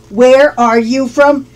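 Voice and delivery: slow, careful teacher's question; deliberate, drawn-out "Where are you from"; slow model. Shown as a demonstration. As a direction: stretch out each word for learners. Teacher repeats question slowly for learners.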